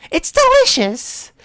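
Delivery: put-on high, slow cute anime girl; mouth-full girl voice. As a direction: savor it, chewing.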